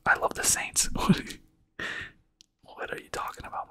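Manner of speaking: ordinary talk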